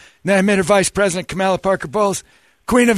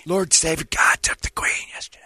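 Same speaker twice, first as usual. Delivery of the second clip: hushed whisper; 4 semitones lower, 5 dB quieter than usual. Acted. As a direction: lean in and whisper it. Confiding aside in whisper.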